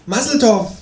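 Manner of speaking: high-pitched creature exclamation; Känguru. A narrator's reading. Kangaroo greets companion out of nowhere, high kangaroo voice. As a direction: announce it brightly, pitched right up. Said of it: "sagt das Känguru".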